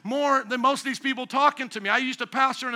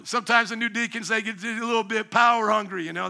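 ordinary talk

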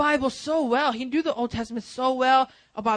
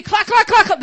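loud, high clacking like a cymbal; clanging cymbal noise; 10 semitones higher, 12 dB louder than usual. Shown as a demonstration. Pastor mimics the cymbal God hears instead of worship, loud clacking. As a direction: bang out the clacks like a hollow cymbal. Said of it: "That's all here God's hearing".

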